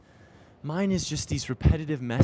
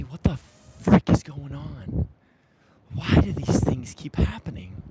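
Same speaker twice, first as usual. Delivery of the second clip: louder, slow, exasperated demand; frustrated outburst; 6 dB louder than usual. Retold or imitated. Venting to the council, a slow exasperated outburst. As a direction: vent at the council like you've had enough. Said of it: "oh, angry".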